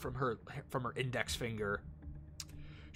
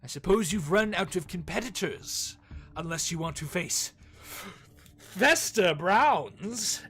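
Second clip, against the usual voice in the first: boastful showman Fester; 4 semitones higher, 5 dB louder than usual. Acted. Innkeeper host offering the elf a bet, pompously. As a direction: sell the bet like a grand carnival host.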